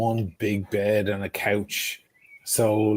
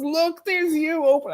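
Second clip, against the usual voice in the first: imitating friend. Friend showing photos.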